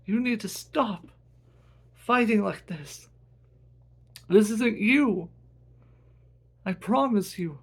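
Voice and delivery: impassioned appeal to a brother; earnest pleading brother. Acted.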